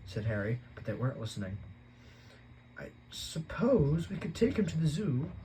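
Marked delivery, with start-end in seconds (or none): 2.8-5.3 slow, higher, musing aunt; slowly. Acted. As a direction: draw it out while thinking. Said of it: "said Aunt Petunia slowly".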